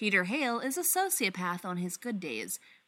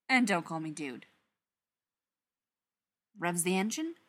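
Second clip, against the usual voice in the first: ordinary delivery, nothing marked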